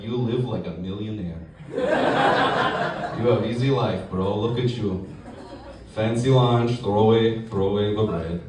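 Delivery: put-on low, judging Russian accent; slow-burning Russian-accented disapproval; Russian coworker. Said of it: "I work with a guy from Russia".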